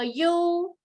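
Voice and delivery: "you" high and level; first tone you. Shown as a demonstration. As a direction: hold "you" flat up high. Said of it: "Say, for example".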